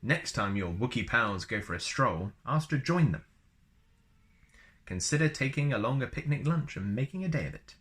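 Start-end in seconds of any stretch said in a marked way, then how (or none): none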